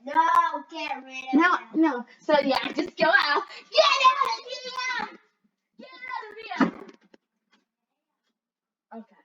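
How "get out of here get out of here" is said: high shout to get out. Pretend. Girl shouting the other player out of her room, high. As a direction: yell at him to leave, dragging it.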